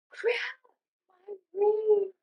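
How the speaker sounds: reliving the frantic search, a bit teary; tearful panic. Retold or imitated. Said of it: "I started crying".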